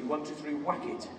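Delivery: ordinary delivery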